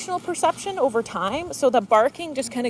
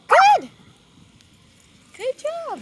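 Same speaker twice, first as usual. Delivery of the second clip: very high, bright burst of praise; excited praise; 9 dB louder, much slower than usual. Real, not acted. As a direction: reward the dog with a delighted squeal. Praising dog after barking.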